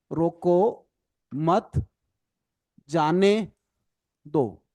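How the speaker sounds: each word alone with long pauses. Shown as a demonstration. Teacher writing out an example line for the class, word by word. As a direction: spell the line out slowly, word after word. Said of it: "अगर मैं ये लिख दूं".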